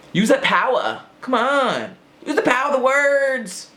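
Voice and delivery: high, urging rallying voice; high rousing call to readers; rallying cry. Acted. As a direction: pump them up to use words.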